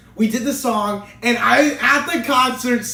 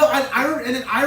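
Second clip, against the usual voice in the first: ordinary talk, nothing marked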